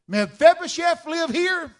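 loud, high call at the tent; commanding shouted question; herald's shout. Acted. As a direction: bellow it like a royal messenger. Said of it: "an entourage come right up to the tent and say".

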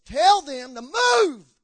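God roars the order, stretching each word; booming voice of God. Retold or imitated. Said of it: "And then the Lord said this".

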